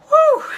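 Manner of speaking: very high, loud exhale of release; relieved whew. Real, not acted. After sharing her painful epiphany, a loud relieved whew. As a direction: blow it all out in relief.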